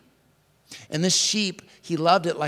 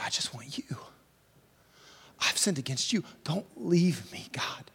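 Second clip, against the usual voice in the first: whispered prayer; 3 semitones lower, 7 dB quieter than usual. Acted. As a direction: barely breathe the plea.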